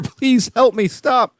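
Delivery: high-pitched mock cry for help; mock plea. Imagined victim calling to a passerby, mock pleading.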